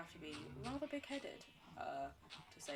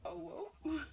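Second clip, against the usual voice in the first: mock surprise; 11 semitones higher, 4 dB louder than usual. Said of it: "we will all just be like".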